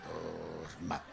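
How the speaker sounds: deep, hollow hypnotist command; cavernous voice. Shown as a demonstration. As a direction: intone it low like a hypnotist. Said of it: "E fiz aquela voz cavernosa".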